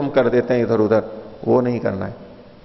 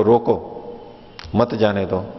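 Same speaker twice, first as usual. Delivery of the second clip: "रोको", a clear pause, then lower "मत जाने दो"; pause after रोको; 3 semitones lower than usual. Shown as a demonstration. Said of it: "नहीं, राजा ने कहा है".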